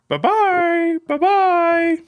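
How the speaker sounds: high, sing-song cartoon goodbye; silly high voice. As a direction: chirp a squeaky farewell. Sign-off in a silly voice.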